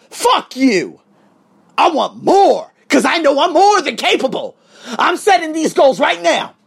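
loud, high, fired-up comeback; defiant retort. Acted. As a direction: shout down your old self. Rebuffs his old self.

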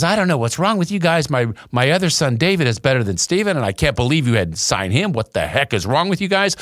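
mimicking an indignant mother chewing out a scout; mom ripping into scout. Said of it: "you should have seen my mother rip into this scout".

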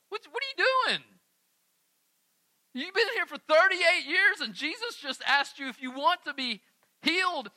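what are you even doing, incredulous. Retold or imitated. Reader reacting to the paralysed man, incredulous.

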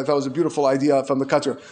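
ordinary delivery

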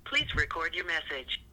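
quiet recorded phone prompt; automated voicemail message; phone menu voice. Acted. Voicemail greeting playing.

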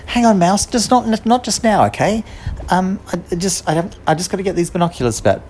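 put-on girl fobbing off a friend; busy girl. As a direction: brush the mouse off while searching. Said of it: "the girl was saying".

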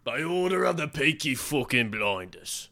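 put-on slow, drawn-out Birmingham accent, a Peaky Blinders line. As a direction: growl it slowly like a Birmingham gangster in Peaky Blinders. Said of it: "that was my Birmingham accent".

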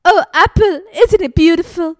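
loud, high, gushing declaration; melodramatic lover. Acted. Apple crying out her love to Pine, loud and high. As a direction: belt it like a soap-opera lover.

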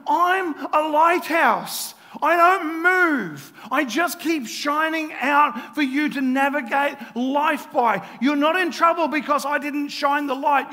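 loud, high cry with drawn-out opening words; frustrated shout. Acted. Jesus as the lighthouse to blamers, frustrated. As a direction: shout it as an exasperated defence. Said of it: "gets frustrated going".

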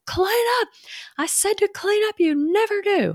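imitating her yelling. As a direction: act out a mom losing her temper at the kids. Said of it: "then I yell at 'em like".

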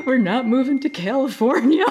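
high, loud, breathless announcement; excited burst. Retold or imitated. Waking her husband with thrilling news, excited and high. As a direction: blurt it out with a gasp.

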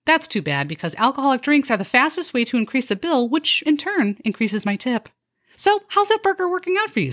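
high, chirpy server voice with a snide edge; a fake-cheerful waitress needling her customers; perky sarcastic waitress. A waitress sweetly insulting her diners, chirpy and high.

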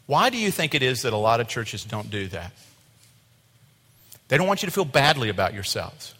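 normal talking voice

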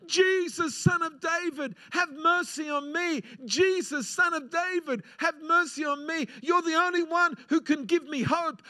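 high pleading cry for mercy. Acted. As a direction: blind beggar crying out for mercy. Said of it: "he started to cry out".

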